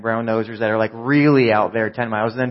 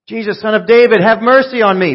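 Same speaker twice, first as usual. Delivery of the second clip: full-force, high-pitched shout; shouting louder; 9 semitones higher, 11 dB louder than usual. Acted. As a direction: bellow desperately for mercy. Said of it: "Bartimaeus only shouted louder".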